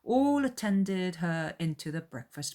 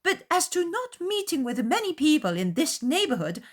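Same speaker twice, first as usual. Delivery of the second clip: high, loud defensive matron; huffy mother; 11 semitones higher, 6 dB louder than usual. A narrator's reading. Mother defending her neighbourhood, high and huffy. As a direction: dig in with wounded pride. Mrs. Bennet voiced.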